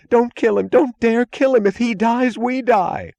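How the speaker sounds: high, loud frantic begging; panicked pleading. A narrator's reading. Mooch begs Floyd not to kill Galt, shrill with panic. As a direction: beg as if your own life hangs on it. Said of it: "he screamed".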